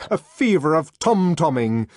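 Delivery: plain reading voice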